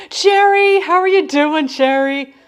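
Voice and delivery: high, loud sing-song hello; sing-song greeting. Real, not acted. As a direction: call out the name brightly like greeting a friend. Greeting a viewer in chat.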